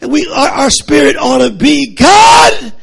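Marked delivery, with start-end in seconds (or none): 1.9-2.7 fervent preaching. Acted. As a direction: cry out to the congregation. Preaching at full force.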